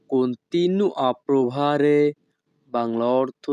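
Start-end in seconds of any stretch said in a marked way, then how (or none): 0.1-2.0 each Italian word spoken slowly and clearly; teacher modelling Italian for learners, very slow; slow demo. Shown as a demonstration. Bengali teacher models Italian phrase for students, slow and careful. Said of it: "Italian ভাষা".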